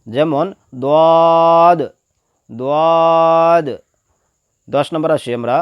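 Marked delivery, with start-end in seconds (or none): 0.8-1.8 দদ full-mouthed and long held; thick heavy d. Shown as a demonstration. Teacher models the letter, thick drawn-out দদ. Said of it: "মোটাভাবে আদায় হবে". 2.5-3.7 দদ full-mouthed and long held; thick heavy d. Shown as a demonstration. Teacher models the letter, thick drawn-out দদ. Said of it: "মোটাভাবে আদায় হবে".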